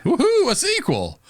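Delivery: high, thrilled woohoo; excited cheer. Real, not acted. Cheering the sequel news.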